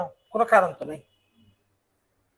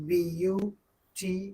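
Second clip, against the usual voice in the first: very slow, softer, broken into separate syllables; 2 semitones lower, 7 dB quieter, much slower than usual. Shown as a demonstration. Teacher showing a class a word too long for one breath, syllable by syllable. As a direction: split "beautiful" into slow pieces with gaps between. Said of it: "এক প্রয়াসে উচ্চারণ করা না যায়".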